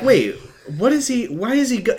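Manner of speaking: high, bewildered "wait, what"; confused surprise. Retold or imitated. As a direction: caught off guard, pitch jumping up. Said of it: "And I'm like".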